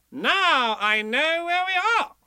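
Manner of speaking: high, loud, slow triumph; triumphant. A narrator's reading. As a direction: announce it like a victory. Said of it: "Rabbit said triumphantly".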